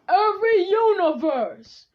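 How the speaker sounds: booming summons, high and stretched out; calling out. Acted. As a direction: summon every universe with a slow, full shout. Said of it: "he calls out".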